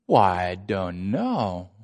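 low, slow, dopey drawn-out denial; mock-innocent slow mumble. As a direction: drag it out quietly like a clueless kid. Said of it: "you expect that from a junior hire".

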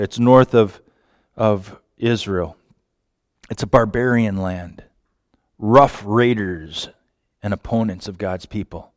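normal talking voice

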